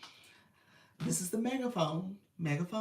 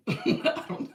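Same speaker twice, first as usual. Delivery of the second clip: auto-tuned laugh; 11 semitones higher, 9 dB louder than usual. Shown as a demonstration. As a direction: laugh through auto-tune filter. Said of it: "Here's my voice with auto-tune".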